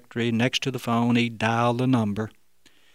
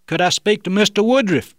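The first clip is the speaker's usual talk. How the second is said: higher, louder put-on phone voice; muffled disguised voice; 9 semitones higher, 6 dB louder than usual. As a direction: mask your voice on the phone. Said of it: "Bob asked in the muffled tone".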